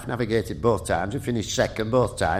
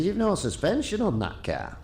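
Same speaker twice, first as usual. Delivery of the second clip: higher-pitched Lancashire voice; imitated Lancashire astonishment, pitched up; Ian Grindrod's Lancashire accent; 7 semitones higher, 4 dB quieter than usual. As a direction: retell it in a raised Lancashire voice. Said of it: "in his Lancashire accent".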